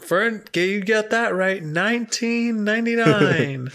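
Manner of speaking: high excited pitchman voice; infomercial. Pretend. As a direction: hype the price like a TV ad. Joins the mock commercial.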